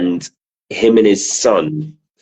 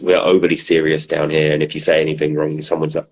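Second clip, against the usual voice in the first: ordinary delivery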